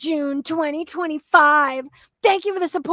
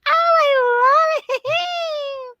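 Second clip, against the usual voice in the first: gushing squeal; 11 semitones higher, slower than usual. Real, not acted. Gushing over cute post.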